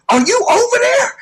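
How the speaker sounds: high disbelieving question; can't believe what you're hearing; incredulous. Retold or imitated. Incredulous reply to aunt.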